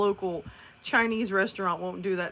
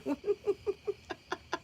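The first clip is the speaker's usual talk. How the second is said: high burst of laughter; 12 semitones higher, 6 dB quieter than usual. Real, not acted. Laughs at Boggs.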